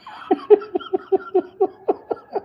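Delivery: very high rising laughter; cackling at the story; high wheezing laugh. Real, not acted. Laughing at dad and geese.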